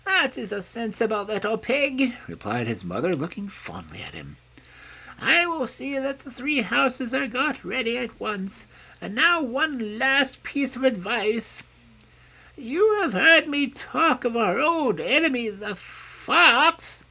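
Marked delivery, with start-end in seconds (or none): 0.0-2.1 put-on high, tender praise of an old sow; mother pig praising her youngest son; fond mother. A narrator's reading. 5.2-17.1 put-on high, gentle voice of an old mother pig; mother pig telling her piglets she is growing old. A narrator's reading. Mother pig warns of fox.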